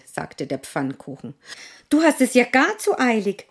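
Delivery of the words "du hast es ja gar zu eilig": hohe, schnelle Hasenstimme; Hase. Acted. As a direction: hastig wie ein Hase. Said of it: "sagte der Hase". (hastiger Hase)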